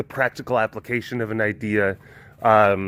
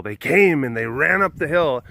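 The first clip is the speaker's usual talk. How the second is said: usual speaking voice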